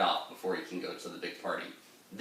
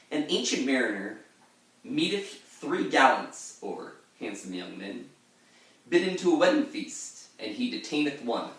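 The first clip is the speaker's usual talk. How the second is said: formal reading; 3 semitones higher than usual. Shown as a demonstration. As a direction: read it like an old scholar. Reads the gloss in a formal voice.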